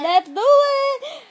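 put-on loud, high, drawn-out cowboy accent; Billy's accent. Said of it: "Okay, there's his accent".

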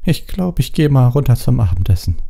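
put-on high, small girl voice; understated misery, high-pitched; miserable. A narrator's reading. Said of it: "Sie sah ganz elend aus".

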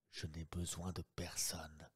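breathy whisper, barely voiced. Lupin to Sholmes in the boat, whispered. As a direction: let it fall to a secret whisper. Lupin's retort, hushed aside.

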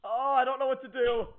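ordinary delivery

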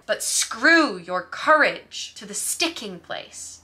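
slow, emphatic Lady Macbeth line; emphatic imploring. Shown as a demonstration. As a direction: stress on "screw", "courage" and "sticking", drawn out slowly. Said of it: "She's emphasizing exactly what she wants him to do".

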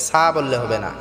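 usual speaking voice

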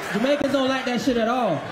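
mock American accent; Yankee accent. Comic showing Jamaicans who talk like Yankees, mock Yankee accent. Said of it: "talk to we like them a Yankee".

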